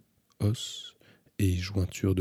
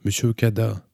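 louder, higher exclamation; raised voice, carrying down; exclaiming; 4 semitones higher, 7 dB louder than usual. A narrator's reading. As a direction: call out in surprised recognition. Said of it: "s'exclama la véritable Kreta Kano".

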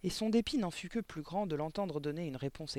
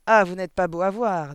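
very loud, higher-pitched disgusted outburst; profound disgust; 4 semitones higher, 15 dB louder than usual. A narrator's reading. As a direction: spit out your disgust at the whole class. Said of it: "avec une expression de profond dégoût". (profond dégoût, très fort)

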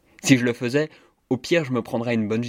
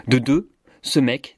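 louder and slow, each word set apart; slow emphatic build; 5 dB louder, slower than usual. Acted. Activist building her accusation against the minister, louder and slow. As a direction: lean hard on ce mec, slowly.

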